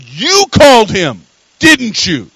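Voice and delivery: loud, drawn-out accusing retort; mock accusation. Girl to her mother before the whole church, acted accusation. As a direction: act out a girl's loud, slow accusation.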